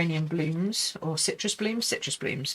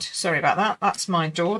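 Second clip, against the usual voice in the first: ordinary talk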